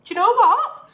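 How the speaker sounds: high, excited "Do you know what?". Retold or imitated. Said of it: "So I thought".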